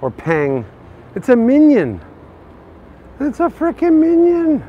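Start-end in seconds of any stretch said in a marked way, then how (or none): 1.1-2.0 excited. Real, not acted. Excited at shop display. 3.2-4.6 high, slow excited squeal; delighted high squeal, drawn out. Real, not acted. Delighted at minion guitar.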